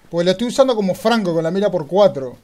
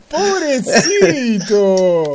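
laughing mock pity; 6 semitones higher, 7 dB louder, much slower than usual. Real, not acted.

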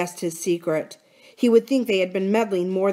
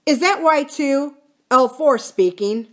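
slow, raised, businesslike phone voice; curt; 7 semitones higher, 6 dB louder, slower than usual. A narrator's reading. Bill answers the tiny phone, curt. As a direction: announce yourself curtly down the line. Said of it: "picked up the tiny phone and spoke curtly".

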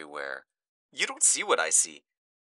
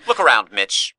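louder, commanding; forceful demand; 8 dB louder than usual. Acted. Speaker confronting Mitch with the chaos, forceful. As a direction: make him look, hard.

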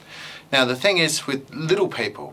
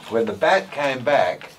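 ordinary talk, nothing marked

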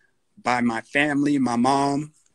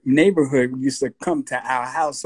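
usual speaking voice

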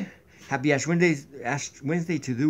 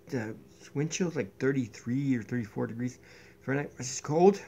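ordinary delivery

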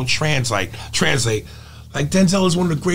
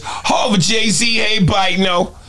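higher-register rapper impression, ending in his laugh; 5 semitones higher, much slower than usual. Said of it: "you know, that register".

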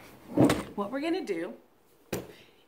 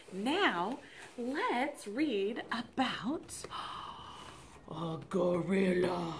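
whispering; 4 semitones lower, 6 dB quieter, slower than usual. Real, not acted. Whispered before bedtime book.

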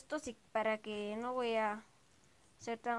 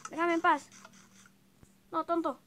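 enfado juguetón; 6 semitones higher, 8 dB louder than usual. Real, not acted.

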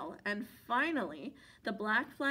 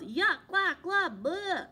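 loud, higher gagging yell; bear yelling yuck; 6 semitones higher, 5 dB louder, slower than usual. A narrator's reading. Bear sprayed in the face, loud gagging yell. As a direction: choke on the spray. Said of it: "The bear yelled".

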